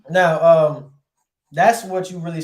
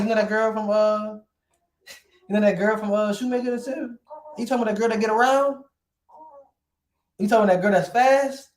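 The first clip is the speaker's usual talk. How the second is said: lightly acted gossip, higher pitch; 6 semitones higher than usual. Someone asking a friend about a girl, lightly gossiping. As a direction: play someone placing a girl from around town.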